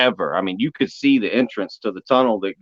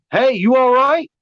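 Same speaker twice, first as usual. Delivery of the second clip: calling guide; 12 semitones higher, 4 dB louder than usual. Retold or imitated. Guide calls out.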